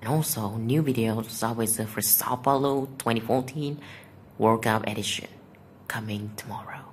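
soft whispered sign-off; whispering. Real, not acted. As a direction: whisper the outro. Whispered outro of the video.

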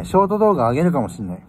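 ordinary talk, nothing marked

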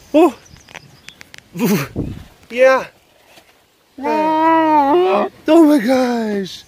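drawn-out high squeal of delight; gushing high squeal. Real, not acted. Cooing to the big cat, drawn-out high squeal. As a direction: stretch it out, squealing with joy.

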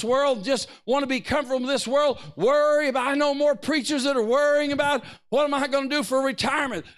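preaching hard against retirement worries; fiery preaching. Acted. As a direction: push the church to stop worrying. Preacher at full force.

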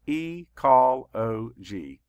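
very slow, each syllable split apart. Shown as a demonstration. Teacher counting syllables for the class, slow and separated. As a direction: chop the word into its beats. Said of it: "Let's count the syllables".

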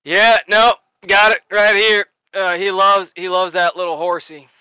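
woozy patient doting on a horsey; giddy sedated voice; drugged patient voice. Acted. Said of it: "he goes".

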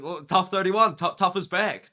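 ordinary talk

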